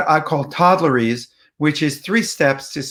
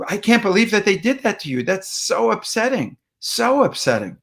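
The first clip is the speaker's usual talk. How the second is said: engaged empathy; 6 semitones higher than usual. Shown as a demonstration. Listener to someone upset, engaged and connected. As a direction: share a third of their upset. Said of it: "That feels engaged and connected".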